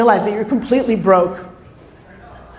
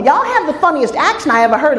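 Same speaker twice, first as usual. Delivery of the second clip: higher-pitched Oklahoma twang, imitating locals; put-on Oklahoma accent, pitched up; mock Oklahoma accent; 5 semitones higher than usual. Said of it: "It was in Oklahoma".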